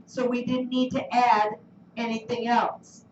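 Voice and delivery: ordinary delivery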